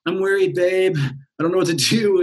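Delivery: the fretting mind whines to its lover, mildly voiced; worried mind. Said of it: "And the mind's like".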